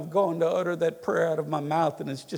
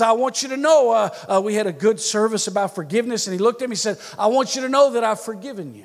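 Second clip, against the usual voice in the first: smug loud quote; 4 semitones higher, 8 dB louder, faster than usual. Retold or imitated. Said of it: "he says".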